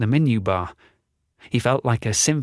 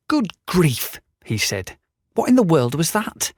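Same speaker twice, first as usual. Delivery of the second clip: startled; 6 semitones higher than usual. Acted. Roger startled by the weapon.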